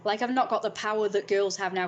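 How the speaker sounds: ordinary talk, nothing marked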